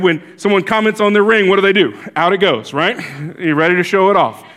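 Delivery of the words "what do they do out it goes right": ordinary delivery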